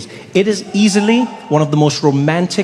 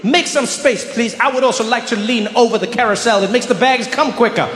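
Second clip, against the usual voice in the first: excited show-off; 8 semitones higher than usual. Retold or imitated. Comedian at the carousel urging his bag out, excited high shout. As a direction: push through the crowd, rising to a shout. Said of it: "I was like".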